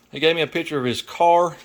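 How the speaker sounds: usual speaking voice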